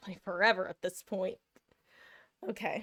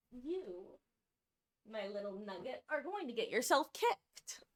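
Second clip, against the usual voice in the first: much softer, a tender little scold; soft scolding to cat; 10 dB quieter than usual. Real, not acted. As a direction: murmur to the cat under your feet.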